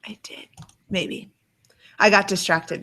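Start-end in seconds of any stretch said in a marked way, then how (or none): 0.1-1.3 hushed whisper to herself. Real, not acted. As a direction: whisper it under your breath. Aside while searching for quote.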